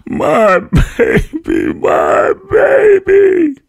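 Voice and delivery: very high, loud, slow anguished cries; crying out. A narrator's reading. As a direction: cry out while rocking the baby. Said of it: "still crying and rocking".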